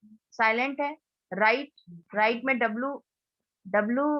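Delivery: ordinary delivery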